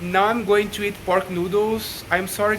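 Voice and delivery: ordinary delivery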